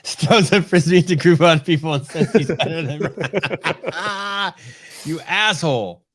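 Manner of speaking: high, loud words broken by laughter; laughing tease. Real, not acted. Mocking his friend's brag, laughing hard. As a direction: tease him while cracking up.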